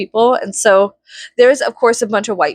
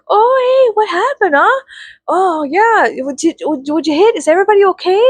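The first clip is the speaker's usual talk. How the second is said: high, bright-eyed Canadian moose voice, much higher; Canadian accent; 11 semitones higher than usual. Retold or imitated. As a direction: play a friendly cartoon Canadian with a Canadian accent. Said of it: "they sounded like the mooses, the moose from Brother Bear".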